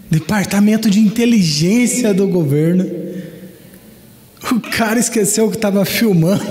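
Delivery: ordinary talk, nothing marked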